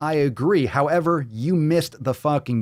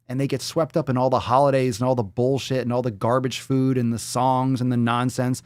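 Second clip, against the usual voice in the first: ordinary delivery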